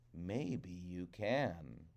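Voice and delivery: slow, low, soft crow voice; Old Man Crow. Acted. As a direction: drawl it out slyly. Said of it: "said Old Man Crow".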